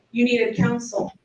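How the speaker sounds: ordinary talk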